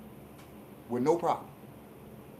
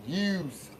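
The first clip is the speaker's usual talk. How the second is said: softer, lightly mock-Italian accent; 9 dB quieter than usual. Sports host repeating his joke word, light Italian voice. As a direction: toss it off with a hint of Italian. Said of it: "in my Italian voice".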